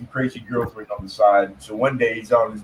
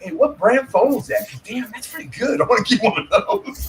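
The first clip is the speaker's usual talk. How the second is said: joking admiration; 8 semitones higher than usual. Retold or imitated. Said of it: "I'm like".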